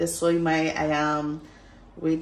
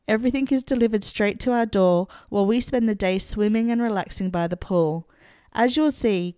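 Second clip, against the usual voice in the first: bright, louder presenter voice; vlog intro; 4 dB louder than usual. Acted. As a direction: present it like a travel vlog host. Upbeat vlog intro to camera.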